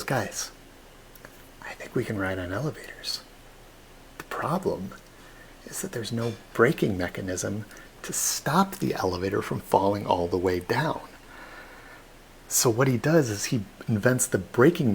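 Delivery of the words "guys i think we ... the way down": hushed Otis. Retold or imitated. Otis pitches his idea.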